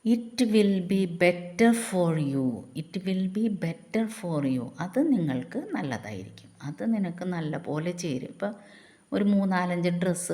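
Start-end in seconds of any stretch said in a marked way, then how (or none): 0.0-4.6 very slow, word by word; slow dictation. Shown as a demonstration. Teacher reading the English prompt slowly to the class. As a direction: spell out each word. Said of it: "slow English model phrase".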